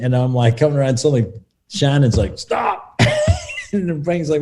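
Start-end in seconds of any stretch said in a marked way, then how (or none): 2.4-3.0 sharp, high stop call; high mock command. Shannon halts the complaining. 3.0-3.7 squeaky burst of laughter; high laugh. Real, not acted. Laughing at own impression.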